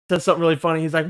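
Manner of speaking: ordinary delivery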